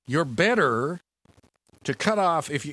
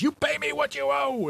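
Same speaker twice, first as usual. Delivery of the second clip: high, harsh demand for payment; demanding creditor. Acted. Forgiven servant choking his debtor, high harsh demand. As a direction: grab him by the throat and demand it.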